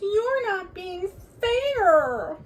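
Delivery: high, slow protesting cub voice; sputtering cubs. Acted. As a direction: whine it like a cub. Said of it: "sputtered the cubs".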